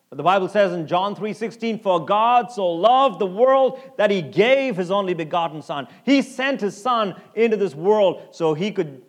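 loud, ringing scripture quote; preaching at full force. Acted. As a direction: proclaim the verse to the whole room. Preacher quoting John 3:16.

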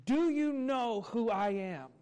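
loud, slow, demanding; insistent. Retold or imitated. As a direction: announce yourself to the whole line, slowly. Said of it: "in a demanding voice".

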